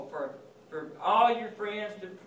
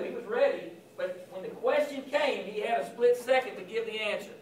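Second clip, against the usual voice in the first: ordinary talk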